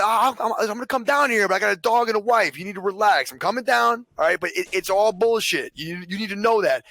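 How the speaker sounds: high, insistent reassurance; pleading with cop. Retold or imitated. Streamer telling the cop to relax, high and insistent.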